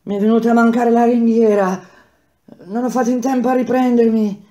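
injured worker panting after his fall; panting in pain. Acted. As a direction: gasp the words through the pain. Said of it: "Ansimava, diceva".

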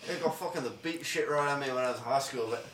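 rambling mock British accent; low, muttered attempt at British; hammed-up British bloke, drifting toward Australian; bad British accent. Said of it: "Do British".

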